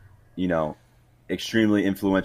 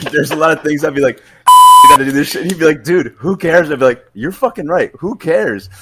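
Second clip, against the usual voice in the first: louder, higher mock exchange, amused; animated retelling; 8 semitones higher, 11 dB louder than usual. Junior retelling a sailor's carefree advice, animated and loud. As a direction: retell the back-and-forth with loud, high energy.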